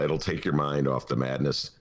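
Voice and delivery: ordinary talk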